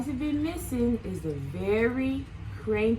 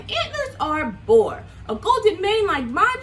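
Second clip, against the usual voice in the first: louder, boastful lion voice; proud Lion; 6 semitones higher, 5 dB louder than usual. Acted. Lion boasting to friends, proud and loud. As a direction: brag about the golden mane. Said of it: "said Lion".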